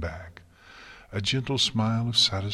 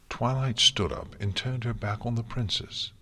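usual speaking voice

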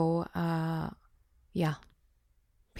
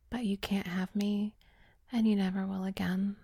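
cold taunting whisper; 7 dB quieter than usual. Acted. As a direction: hiss the taunts under your breath.

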